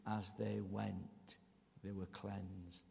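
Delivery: preacher lingering on the key phrase; slow emphasis. Shown as a demonstration. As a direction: spell out each word slowly for the congregation. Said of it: "The important two words, three words is".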